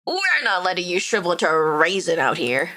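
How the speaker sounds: normal talking voice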